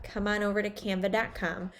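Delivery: normal talking voice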